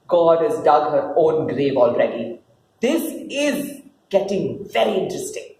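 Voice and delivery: normal talking voice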